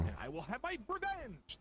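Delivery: hint of a seething spirit; Ganon voice. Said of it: "now he's gonna voice act for us".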